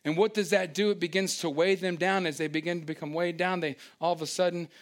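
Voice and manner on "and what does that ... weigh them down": ordinary talk, nothing marked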